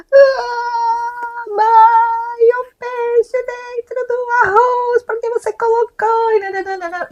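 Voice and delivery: put-on child's voice way up high, slowed and wailing; whiny crying child. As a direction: wail it like a nine-year-old in a tantrum. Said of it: "ela fez uma birra". (criança chorosa fazendo birra)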